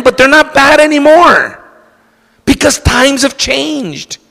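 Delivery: high-pitched, insistent protest; insistent excuse-making. Acted. Acting out the modern excuse-maker.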